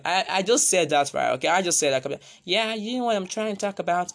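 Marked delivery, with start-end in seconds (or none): 2.4-4.1 higher disguised voice; changed voice. Shown as a demonstration. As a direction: pitch it up to sound like someone else. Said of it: "I just changed my voice".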